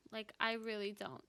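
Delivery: ordinary delivery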